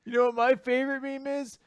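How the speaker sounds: high, very slow pun punchline; loud put-on voice milking the pun; hammed-up punning. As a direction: draw out each word of the corny joke. Playing Asta forcing another tree pun.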